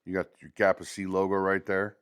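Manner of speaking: ordinary delivery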